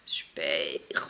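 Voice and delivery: breathy whispered super; sarcastic whisper. Real, not acted. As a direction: mutter it dryly under breath. Sarcastic reaction to swollen cheek.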